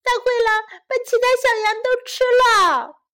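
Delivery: high, whining little-lamb sob; acted childlike crying. A narrator's reading. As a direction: wail it tearfully, stretching the words. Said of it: "羊小妹哭着说".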